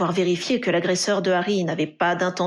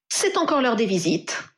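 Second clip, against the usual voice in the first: with aplomb; 3 semitones higher than usual. A narrator's reading. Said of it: "affirma Ginny avec aplomb".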